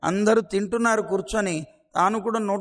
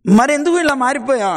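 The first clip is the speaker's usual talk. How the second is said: loud, high outburst; shocked demand; 4 semitones higher, 7 dB louder than usual. Acted. Gopika demands why the boy changed, loud and shocked. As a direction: blurt it out in disbelief.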